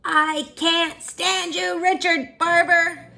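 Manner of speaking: put-on high, slow, loud kid yelling; childish scream. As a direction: scream it at your big brother. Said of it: "I screamed".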